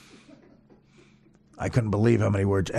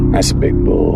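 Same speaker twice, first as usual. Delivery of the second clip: very low, loud gruff line; mock deep voice; 7 dB louder than usual. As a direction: drop into a cartoonish low growl. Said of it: "Here's what he said".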